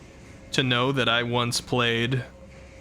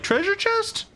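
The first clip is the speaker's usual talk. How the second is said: pitched way up in surprise; high squeaky question; slower than usual. Retold or imitated. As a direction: squeak it out high, mock excited. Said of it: "I'm like".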